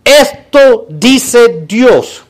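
very slow, weighty proclamation; pompous religious leader. Retold or imitated. Religious leaders claiming to speak for God, slow mock proclamation. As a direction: stretch each word like a divine decree. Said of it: "están constantemente diciendo".

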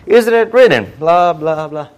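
high, loud mock retort; devil. Devil quoting scripture back at Jesus, high loud retort. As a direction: throw it back high and loud, then blah it away. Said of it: "the devil comes back".

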